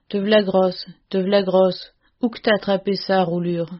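ordinary delivery, nothing marked

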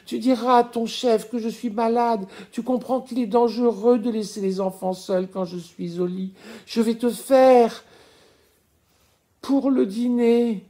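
fond and fretful wife from far away; thin delirious voice. Acted. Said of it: "d'une petite voix maigre qu'on ne lui connaissait pas".

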